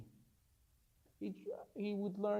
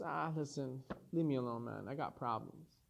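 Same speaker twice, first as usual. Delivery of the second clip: depressed angel; 7 semitones lower, 8 dB quieter than usual. Acted. Depressed angel of death speaks.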